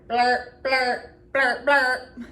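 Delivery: loud buzzing horn blurps. Shown as a demonstration. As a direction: blow it like a horn. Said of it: "He can go like a horn".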